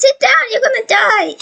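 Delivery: high-pitched, urgent command; urgent warning. Real, not acted. Player ordering friend to sit before dying, urgent high warning. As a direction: warn in a high, panicky rush.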